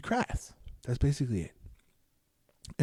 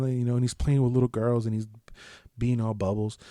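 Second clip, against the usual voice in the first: ordinary delivery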